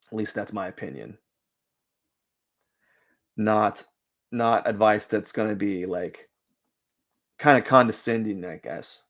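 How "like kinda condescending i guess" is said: ordinary delivery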